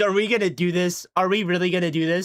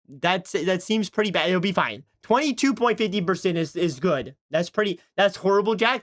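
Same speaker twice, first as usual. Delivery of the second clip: ordinary delivery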